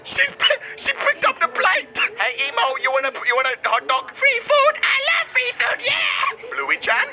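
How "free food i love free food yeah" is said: high, excited cartoon girl begging for food; voiced game character; squeaky character voice. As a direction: play a greedy little anime girl.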